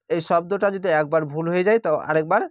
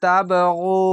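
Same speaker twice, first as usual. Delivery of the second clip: slow, drawn-out chanted recitation; Quranic recitation; 4 dB louder, much slower than usual. Shown as a demonstration. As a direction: recite each word slowly with tajweed. Recited demo.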